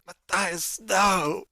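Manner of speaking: high, loud cry of horror; screamed cry. A narrator's reading. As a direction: scream out as a friend is attacked. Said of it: "just screams out".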